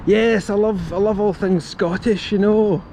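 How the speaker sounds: playful Scottish comedian impression. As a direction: ham up a Glasgow comic's lilt. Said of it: "you sound a wee bit like [name] doing that impression".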